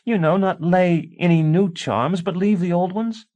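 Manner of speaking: high pleading wife voice; wife begging her husband to keep the charms; Tansy, pleading wife. A narrator's reading.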